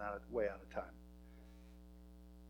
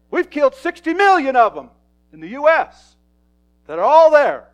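shouted preaching; 11 semitones higher, 22 dB louder than usual. Acted. Pastor tells the church heaven is full of kids, shouting. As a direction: roar it out to the back row.

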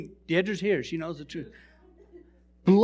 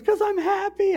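high-pitched weepy imitation; put-on blubbering happy voice; mock bawling; 10 semitones higher than usual. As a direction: squeak it out as a tearful woman. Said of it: "She's bawling".

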